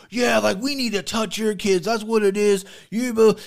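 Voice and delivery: mocking the guy. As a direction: ham up his ranting whine.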